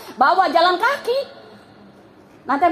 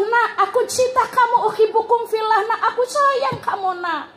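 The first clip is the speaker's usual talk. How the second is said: put-on higher, loving mother talking to her child; tender mother; 8 semitones higher than usual. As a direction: coo it to a beloved child. Mother voiced telling child love.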